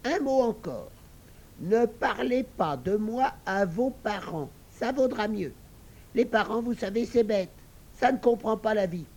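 piglet talking his way out of a lie; sly piglet. Acted. Little pig warns the girls not to believe Aurore, high and sly.

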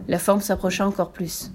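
ordinary delivery, nothing marked

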